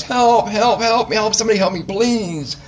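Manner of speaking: high-pitched pleading cry from the bottom of a hole; desperate cry for help. Acted. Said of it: "he cries, 'Help".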